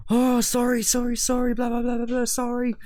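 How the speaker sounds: high, loud apologetic Scottish voice; retelling the other driver's apology in a Scottish accent. Retold or imitated. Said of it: "but in, like, proper Scottish accent".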